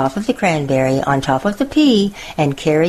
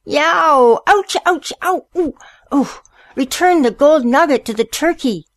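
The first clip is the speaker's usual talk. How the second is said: very high, hammed-up yelps of pain; storybook mayor yelling in mock pain; 10 semitones higher, 3 dB louder than usual. A narrator's reading. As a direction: yelp as if being bitten, then call out a high, frantic order. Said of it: "jumped up from the chair and yelled".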